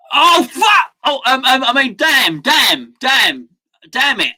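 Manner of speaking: high, loud outburst of swearing; shouted cursing. Real, not acted. Watching the match go wrong, loud frustrated cursing. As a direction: blurt it out, then hammer each damn.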